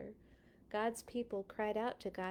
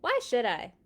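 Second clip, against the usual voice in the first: defiant Pharaoh; 2 semitones higher, 8 dB louder than usual. Acted. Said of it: "Pharaoh said".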